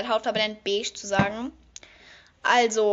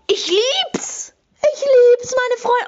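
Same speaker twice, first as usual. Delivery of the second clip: high, loud squeal with a whimpering cry in it; overjoyed squeal; 13 semitones higher, 7 dB louder, much slower than usual. Real, not acted. To her friends watching, a high loud tearful squeal. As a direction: squeal your love for it, nearly in tears. Overjoyed about palette colour.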